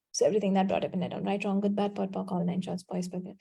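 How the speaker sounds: fast, quiet recited clearing formula; rote clearing statement. Acted. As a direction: rattle it off fast and soft, fading at the end. Clearing statement recited third time.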